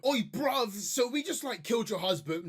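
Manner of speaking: a badly faked London thug accent; rough London slang. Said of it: "I'm not doing the accent well enough".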